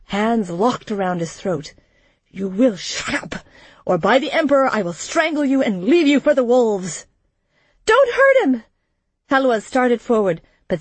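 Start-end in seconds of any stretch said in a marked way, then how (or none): 2.3-7.1 threat building from held-in to high and quick; mounting anger, a little restrained; angry threat. A narrator's reading. Throttling threat after yelling. 7.8-8.6 very high, loud plea; distressed, high-pitched protest; alarmed cry. A narrator's reading. Girl pleads against violence.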